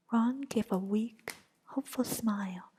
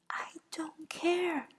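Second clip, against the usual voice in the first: whispered mock scream; 7 semitones higher, slower than usual. A narrator's reading. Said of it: "she screamed".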